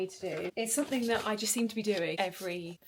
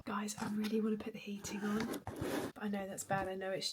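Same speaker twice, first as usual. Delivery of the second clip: softer, breathy confession to the camera; whisper; 6 dB quieter than usual. Real, not acted. Confessing to viewers she wants the heating on, whispering. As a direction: whisper it like a guilty secret.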